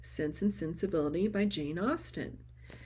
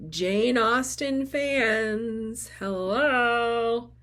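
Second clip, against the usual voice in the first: louder, drawn-out fan shout-out; excited greeting; 4 semitones higher, 7 dB louder, much slower than usual. Real, not acted. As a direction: call out to the Austen fans with delight. Excited greeting to fellow fans.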